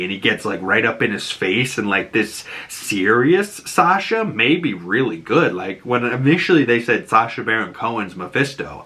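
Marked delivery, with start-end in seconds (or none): none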